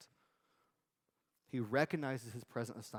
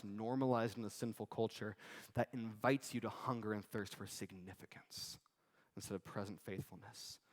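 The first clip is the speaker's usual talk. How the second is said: ordinary talk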